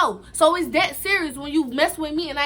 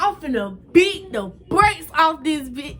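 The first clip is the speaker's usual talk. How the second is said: angry threat; 4 semitones higher, 6 dB louder, slower than usual. Retold or imitated. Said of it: "I was so mad".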